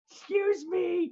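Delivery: very high, slow squeaky excuse me; cheeky high-voiced skit line; silly comic voice. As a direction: squeak it out like a prim kid. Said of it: "but instead I say".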